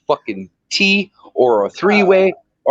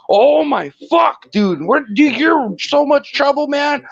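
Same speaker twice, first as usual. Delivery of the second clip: higher, shouted mock panic, slow at first; freaked-out yell; 9 semitones higher than usual. Telling friends how he yelled at the driver, mock panic. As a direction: yell it like you just saw the wrecked tire. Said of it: "And I'm like".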